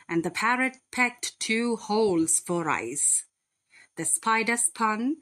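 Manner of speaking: plain reading voice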